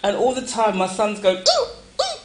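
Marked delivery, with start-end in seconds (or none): 1.4-2.2 very high squeaky ooh-ooh; mock child's squeal. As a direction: imitate the little boy's scared ooh. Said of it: "like this".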